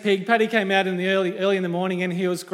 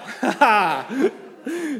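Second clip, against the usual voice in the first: laugh; 5 semitones higher than usual. Real, not acted. Preacher joking that his colleague isn't here, laughing. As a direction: laugh out at your own cheek.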